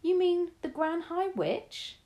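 put-on high, slow, astonished boy's cry; excited cry. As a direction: gasp it out in wonder, drawn out. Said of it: "I cried".

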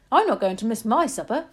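put-on greedy boy mouse with his mouth full. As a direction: say it while chewing. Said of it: "speaking with his mouth full of banana".

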